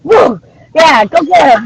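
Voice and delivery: high, loud whoop of excitement; excited cheer. Real, not acted. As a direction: cheer it out like a fan. Cheering on an imagined hunt.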